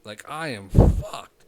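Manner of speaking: slow, loud dread; panicked outburst. Real, not acted. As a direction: blurt it out in panic. Panic realising jail risk.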